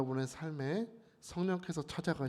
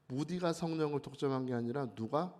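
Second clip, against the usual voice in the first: normal talking voice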